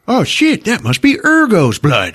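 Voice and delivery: high mock-shocked exclamation; hammed-up hero alarm; alarmed realization. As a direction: blurt it out on realizing. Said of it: "Colwyn's like".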